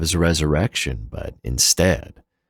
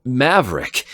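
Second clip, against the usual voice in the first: "Maverick!" cried out higher as it hits him; sharp cry; 9 semitones higher, 3 dB louder than usual. Acted. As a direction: blurt "Maverick!" as the memory strikes. Memory floods back, cries out.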